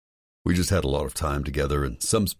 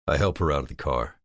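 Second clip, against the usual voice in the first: ordinary delivery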